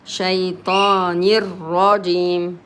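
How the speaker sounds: شيطان الرجيم running straight into a long r; doubled ra. Shown as a demonstration. Teacher says ra directly, doubling the r of شيطان الرجيم. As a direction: skip the lam and double the r in الرجيم. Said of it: "Ra directly, as if there is two letters".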